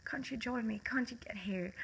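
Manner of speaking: soft unvoiced whisper, reaching out; whispered plea. Teen whispering to classmates to join her. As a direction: whisper it like begging someone to follow.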